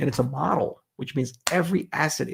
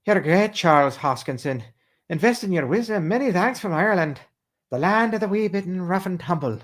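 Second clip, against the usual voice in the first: a lightly put-on fake Irish accent, a bit higher; Tom Cruise fake Irish; 6 semitones higher, 3 dB louder than usual. Reading a viewer's Irish message in a light fake Irish accent. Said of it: "my Tom Cruise pretending to be Irish accent".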